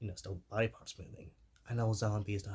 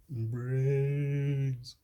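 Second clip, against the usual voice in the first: long, loud, higher zombie groan of BRAINS; drawn-out zombie moan, swelling louder; zombie call; 5 semitones higher, 7 dB louder than usual. Shown as a demonstration. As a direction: stretch out "brains" like a hungry zombie. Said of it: "if you wanna say brains".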